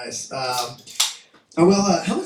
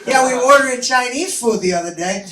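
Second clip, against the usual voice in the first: loud, higher-pitched Boston woman; imitating Boston accent; 7 semitones higher, 5 dB louder than usual. Coffee shop customer insisting on her story, loud Boston accent. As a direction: barrel on in a mock Boston accent. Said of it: "She had a thick Boston accent".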